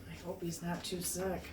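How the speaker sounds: ordinary delivery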